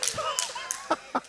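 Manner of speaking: a high, soft giggle; high breathy laugh. Real, not acted. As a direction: let a small high laugh escape. Laughing at own joke.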